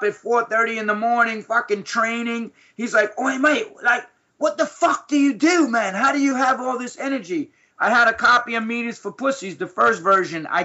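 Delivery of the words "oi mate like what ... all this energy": a light Aussie-bloke voice, pitched up; hint of an Aussie fighter asking; imitating Aussie mate. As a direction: mimic a blunt Australian mate. Friend Ian quoted, Aussie voice.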